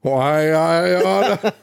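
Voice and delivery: high, drawn-out blustering sitcom husband impression; Jackie Gleason impression. Riffing on The Honeymooners with a co-host, hammed-up impression.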